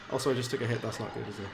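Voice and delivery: normal talking voice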